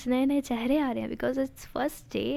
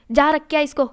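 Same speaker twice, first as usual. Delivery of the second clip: much louder, angry yell; sudden shout; 4 semitones higher, 12 dB louder than usual. A narrator's reading. Tanu suddenly yelling at Sharvari to go, angry shout. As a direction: blast the order at her. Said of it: "एकदम से चिल्लाती है तनु".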